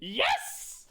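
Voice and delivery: high, loud triumphant yell; excited shout. Real, not acted. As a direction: cheer it out. Cheering the ambush.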